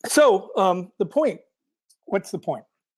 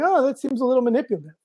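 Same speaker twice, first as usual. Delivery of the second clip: skeptical; 8 semitones higher, faster than usual. Retold or imitated. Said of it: "I first came to it going".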